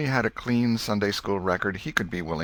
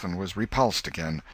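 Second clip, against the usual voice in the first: usual speaking voice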